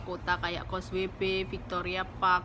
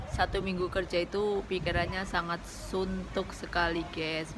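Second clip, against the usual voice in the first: ordinary delivery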